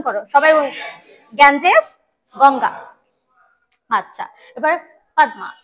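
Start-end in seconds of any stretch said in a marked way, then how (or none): none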